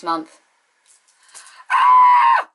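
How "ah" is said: sudden high yelp of pure shock; shocked scream. Real, not acted. Vlogger hearing Lesnar versus Taker announced, shocked scream. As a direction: let a scream of disbelief burst out.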